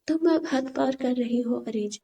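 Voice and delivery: plain reading voice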